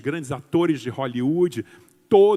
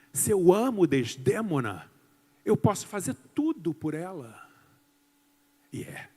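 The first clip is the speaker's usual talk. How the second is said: ordinary talk, nothing marked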